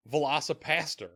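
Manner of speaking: ordinary talk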